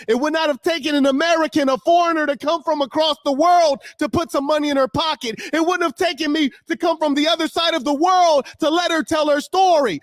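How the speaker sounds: high, loud shouting rebuke; furious shout. Real, not acted. Streamer rebuking commenters who only talk, furious shout. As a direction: hammer the point home, yelling.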